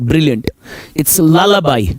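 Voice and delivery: usual speaking voice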